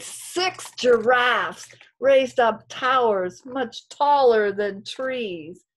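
put-on lilting read-aloud for kids; reading to children. A narrator's reading. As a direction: storytime rhythm, gentle and sing-song. Reads story to class.

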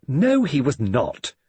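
slow, icy and controlled; cold fury. A narrator's reading. Judith denies Allerton was with her, in cold fury. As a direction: spell each word out coldly. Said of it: "in tones of cold fury".

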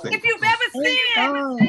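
high, loud and slow preaching cry; preacher shouting to the congregation. Real, not acted. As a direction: stretch it out to the crowd. Preacher call over music.

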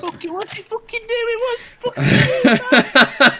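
high, loud disbelieving yell through a laugh; yelling in shock at the enemy; excited laughing yell. Real, not acted. To his duo partner, a laughing shocked yell. Teammate startled mid-fight.

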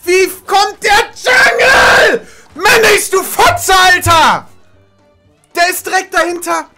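slow high furious yell; panicking gamer. Real, not acted. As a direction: scream in gamer rage. Raging at enemy jungle.

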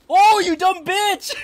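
high, loud, slow yell; laughing shout. Real, not acted. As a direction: shriek it with glee. Enemy breaks its leg.

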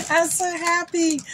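high, giggly gush of happiness; overjoyed. Real, not acted. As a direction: gush it high, just out of a laugh.

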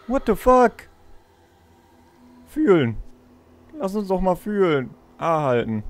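ordinary delivery

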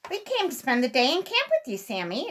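put-on an eager little rabbit boy; young rabbit voice. A narrator's reading. Johnny greeting Uncle Wiggily at camp, eager and high.

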